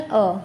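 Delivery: "अ" as a short neutral uh; letter A as schwa. Shown as a demonstration. Teacher shows the first sound of letter A, "अ" as short uh. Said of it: "ए का उच्चारण".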